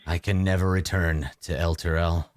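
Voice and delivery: low, grave vampire lord; brooding vampire. Acted. DM voices a weary vampire to the party, low and grave.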